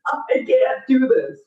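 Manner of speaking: drawn-out hopeless mock whine. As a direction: whine it like you've given up. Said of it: "I was like".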